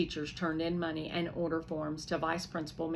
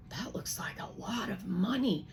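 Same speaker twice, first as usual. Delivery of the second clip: gasped in awe; 3 dB quieter than usual. Acted. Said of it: "I gasp".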